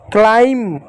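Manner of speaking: usual speaking voice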